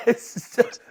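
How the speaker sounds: laughing hard. Real, not acted. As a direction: bursts out laughing. Laughs after his own story.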